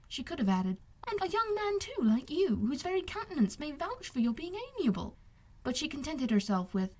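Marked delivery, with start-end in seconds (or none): 1.1-5.2 put-on higher, earnest young woman; admiring young lady. Elizabeth's unspoken praise of Wickham, higher and earnest. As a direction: say it warmly to him.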